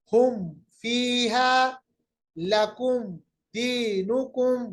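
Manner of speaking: slow recitation, meem held clearly without nasal hum. Shown as a demonstration. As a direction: recite हुम फीहा with the meem closed crisply, no ghunna. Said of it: "देखिए मीम को बिल्कुल हमने जाहिर किया".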